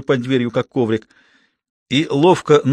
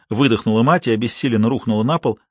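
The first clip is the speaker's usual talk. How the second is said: plain reading voice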